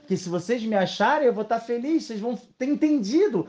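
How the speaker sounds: rising into a high, urgent appeal; heartfelt acted entreaty, increasingly high; impassioned plea. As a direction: plead to be found, building in pitch. Voices God pleading to be found.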